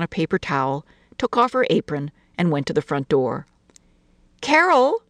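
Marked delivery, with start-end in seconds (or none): 4.4-5.0 calling lightly, higher. A narrator's reading. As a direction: sing out a light call to a child. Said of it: "she called lightly".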